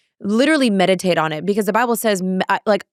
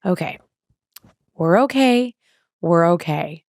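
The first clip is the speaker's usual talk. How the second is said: self-soothing; 3 semitones lower than usual. Retold or imitated. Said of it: "I just sat there at the gym by myself".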